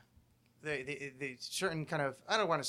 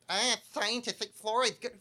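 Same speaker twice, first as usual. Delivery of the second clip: slow, higher smug approving voice; mock believer; 6 semitones higher, 3 dB louder than usual. Mocking fluoride believers, slow smug voice. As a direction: nod along like a gullible sheep. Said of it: "you're like".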